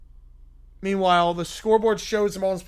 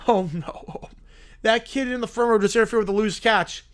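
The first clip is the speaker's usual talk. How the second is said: ordinary talk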